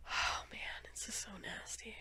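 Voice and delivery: soft, unvoiced disgust; a soft whisper of disgust. Real, not acted. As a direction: breathe it out, grossed out. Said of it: "whispered disgust".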